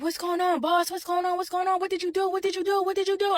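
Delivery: mock scream the rapid repeated questions on one high note; retold frantic screaming. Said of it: "I'm screaming".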